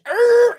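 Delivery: loud, high squeal of tires; imitated car screech. Woman racing up in her Camaro, imitated screech.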